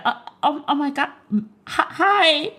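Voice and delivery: high, flustered starstruck stammer; nervous awkward fan. Pretend. Meeting [name] in person, a high flustered stammer. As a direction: fumble the hello like a starstruck fan. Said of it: "I be so nervous and awkward".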